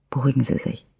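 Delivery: softly soothing the shaken driver; calming. A narrator's reading. Detective calming a shaken witness, soft and reassuring. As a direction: ease him down gently and quietly. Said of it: "beruhigte ihn Franza".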